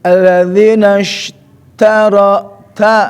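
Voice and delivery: slow, drawn recitation; bacaan tilawah. Shown as a demonstration. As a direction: recite it slowly, like Quran reading. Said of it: "Alangkah baiknya". (lantunan tilawah perlahan)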